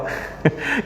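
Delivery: soft breathy chuckle; quiet amused chuckle; airy laugh. Real, not acted. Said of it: "anak kurang ngajar".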